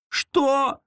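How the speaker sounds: high frightened squeal. Acted. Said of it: "взвизгнула сестра". (испуганный взвизг)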